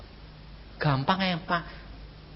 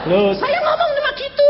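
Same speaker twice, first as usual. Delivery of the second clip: much higher and louder, a ringing cry; excited exclamation; 10 dB louder than usual. Real, not acted. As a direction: throw it out to the crowd with excitement. Preacher exclaims at congregation. (seruan bersemangat kepada jemaat)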